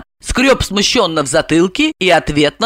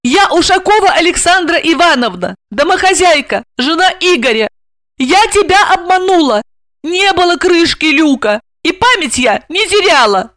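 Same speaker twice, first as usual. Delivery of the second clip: высокий голос героини-домохозяйки; героиня признаётся; 7 semitones higher than usual. Acted. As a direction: confess in a high female character voice. Voices Asya confessing. (высоким голосом, признаваясь во всём)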